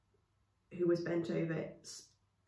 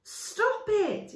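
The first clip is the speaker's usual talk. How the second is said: high, loud yell; 5 dB louder than usual. Acted. Chaya fighting the men holding her, yelling. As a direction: yell to make them stop. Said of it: "yelled Chaya".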